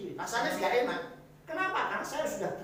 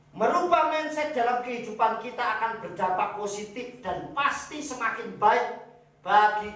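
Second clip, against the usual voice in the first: loud preaching; 5 semitones higher, 6 dB louder than usual. Acted. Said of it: "repeated point, preached loud".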